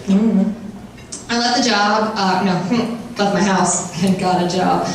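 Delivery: ordinary talk, nothing marked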